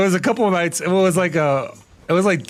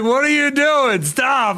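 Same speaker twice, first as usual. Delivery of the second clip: friends protesting; 9 semitones higher, much slower than usual. Retold or imitated. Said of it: "And everyone's like".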